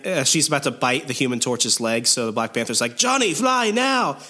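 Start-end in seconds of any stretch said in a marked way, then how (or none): 3.0-4.2 high, drawn-out mock shout of warning; mock urgent yell. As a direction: call out a high-pitched warning, stretching it.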